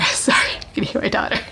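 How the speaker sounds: hushed apologetic whisper. Real, not acted. Vlogger to viewers apologizing for daughter's noise, whispering.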